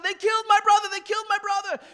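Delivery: high, loud anguished cry; screaming. Retold or imitated. Kid telling Angel of his brother's killing, screaming. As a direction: scream the news in desperation, as the kid. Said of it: "he's screaming".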